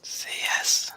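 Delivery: tempting whisper, barely voiced. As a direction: breathe it like a sly temptation. GM tempting the player to accept.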